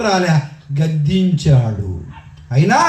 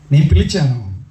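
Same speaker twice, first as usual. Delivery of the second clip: ordinary delivery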